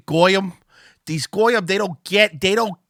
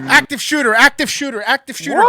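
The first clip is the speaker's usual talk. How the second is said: mock alarm call; 7 semitones higher, 3 dB louder, faster than usual. Mock school lockdown alarm.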